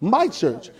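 "my" singled out against "the"; stress on "my". Shown as a demonstration. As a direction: land firmly on "my", letting "church" fall. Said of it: "not the church".

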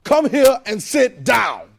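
loud, slow, forceful order; stern commanding tone. Shown as a demonstration. Preacher showing how tone changes words, stern shout. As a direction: shout it like a parent who means business. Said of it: "different thing because of my tone".

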